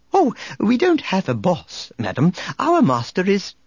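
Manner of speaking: high-pitched, fussy droid voice; prim protocol droid. Acted. Droid correcting the barkeep about his master, prim and high. C-3PO objecting, gets cut off.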